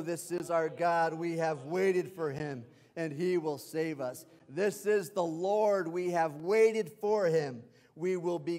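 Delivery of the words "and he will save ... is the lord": normal talking voice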